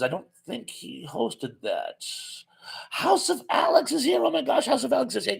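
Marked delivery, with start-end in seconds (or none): 2.9-5.4 high-pitched, delighted recognition; excited surprise. Real, not acted. Host spots House of Alex joining, excited surprise. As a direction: burst out high as a favorite guest turns up. Greeting a viewer who just arrived.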